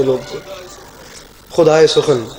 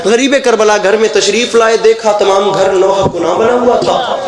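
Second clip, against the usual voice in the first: normal talking voice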